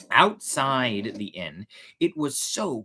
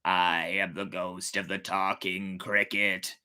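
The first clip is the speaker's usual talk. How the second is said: a lightly lowered, softer ghost voice; lightly voiced ghost cricket; low ghostly voice; 5 semitones lower, 4 dB quieter than usual. A narrator's reading. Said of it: "answered the insect in a low voice".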